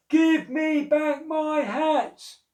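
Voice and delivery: slow, loud, high cross shout; shouting at the monkeys. Acted. Cross hat seller shouting up at the monkeys, slow loud shout. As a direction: bellow it up into the trees. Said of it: "he shouted out at them".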